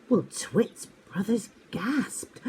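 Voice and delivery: plain reading voice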